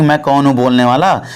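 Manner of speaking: ordinary talk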